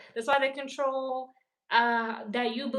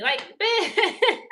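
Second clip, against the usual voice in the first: laughing; 8 semitones higher, 5 dB louder than usual. Real, not acted. Laughs mid rant.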